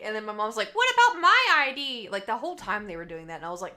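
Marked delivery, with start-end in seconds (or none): 0.7-2.1 high, very loud mock yell; hammed-up shout for attention; imitated indignant mom. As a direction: butt in loudly as an offended mom. Mom butting in, retold.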